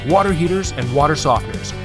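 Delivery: ordinary delivery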